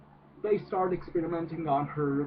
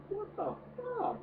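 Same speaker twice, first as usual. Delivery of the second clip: much higher, slow incredulous squeak; high squeak of disbelief; 6 dB quieter than usual. Retold or imitated. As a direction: squeal it out, utterly baffled. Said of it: "and I'm just like".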